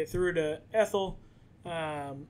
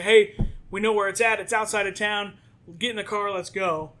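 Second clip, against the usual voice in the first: louder and higher, hurry them along; urgent call; 4 semitones higher, 8 dB louder than usual. Acted. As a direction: rush them to the car. Friends urging them into the car.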